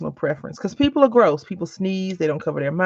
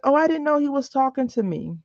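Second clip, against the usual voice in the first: excuse-making lady; 8 semitones higher than usual. Retold or imitated. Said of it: "and she's like".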